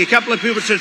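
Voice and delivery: usual speaking voice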